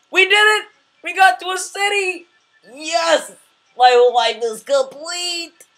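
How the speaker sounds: very high, loud celebration; triumphant cheer. Real, not acted. Gamer finally reaching a city, jubilant shouting. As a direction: cheer wildly, stretching out the last words.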